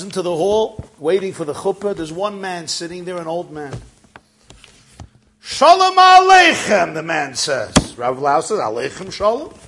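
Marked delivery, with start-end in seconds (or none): 5.4-6.9 loud, high, drawn-out call; hearty shouted greeting. Retold or imitated. Old man greeting the arriving rabbi, loud high call. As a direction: boom out a long, high greeting.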